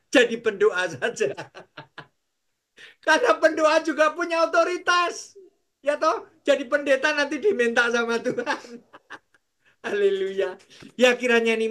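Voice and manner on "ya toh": ordinary delivery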